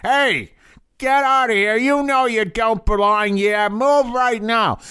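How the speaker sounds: pushy New York tough, pitched high; a New York cop ordering them away; New York accent. Retold or imitated. Street guys chasing the camera crew, New York accent. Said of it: "you know, that New York accent".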